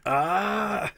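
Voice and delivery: high squeal of delight; little squeal. Real, not acted. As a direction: squeal at the silly face. Squealing at the silly face.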